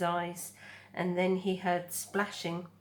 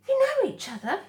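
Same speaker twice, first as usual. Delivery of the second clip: high gosling voice; 8 dB louder, faster than usual. Acted. Gosling voice in read story.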